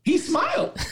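very high, squealed delight with laughter; real delight. Real, not acted. Coach marvelling over his player, high-pitched delight. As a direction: let the pitch leap in amazed joy.

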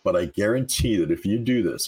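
normal talking voice

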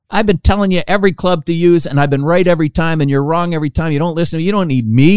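high-pitched exasperated rant, imitated; retold frustration. Caddy tells off Charles Barkley, acted exasperation. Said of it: "this guy's getting really frustrated".

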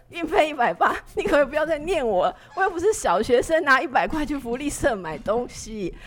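can you stop nagging me, exasperated; exasperated outburst. Retold or imitated. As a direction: let the frustration burst out. Venting pent-up frustration at mother.

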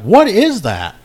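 baffled outcry. Retold or imitated. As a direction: yelp it out, shocked. Baffled brother asks again, louder.

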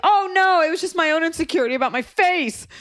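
high, loud mock wail; mock overreaction. Imagined woman answering an apology, mock wail.

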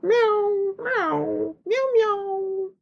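high, drawn-out cat meows; stray cat meowing. Retold or imitated. Stray cat begging for milk, high meows. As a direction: mew like a stray begging for a handout. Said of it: "a stray cat walking down the street".

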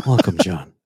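calm radio host greeting a caller. Shown as a demonstration. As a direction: soothe the caller in gently. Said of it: "This is my calm voice".

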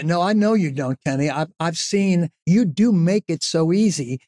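usual speaking voice

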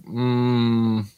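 ordinary talk, nothing marked

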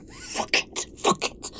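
hushed, breath-only swearing; pained cursing. Acted. Trapped woman hurt trying to escape, whispered cursing. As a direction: curse under your breath after hurting yourself.

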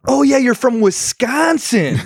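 high, excited imitation of a stranger; hammed-up, high-pitched reaction; mock recognition. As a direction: act out a sudden delighted realization. Voicing people's reaction to Wisconsin.

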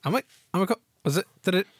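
high, halting imitation of astonishment; teasing high-pitched bewilderment; mock disbelief. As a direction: stammer it in mock amazement. Mimics co-host's stunned reaction.

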